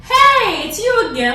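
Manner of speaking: a bartender lighting up at a regular; excited bartender. Retold or imitated. Bartender greets returning hotel guest at bar, excited and loud.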